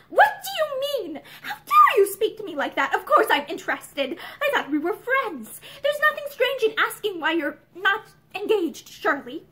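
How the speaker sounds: high, agitated indignation; agitated outburst easing off at the end; flustered, frightened protest. A narrator's reading. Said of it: "She spoke incoherently. She was frightened".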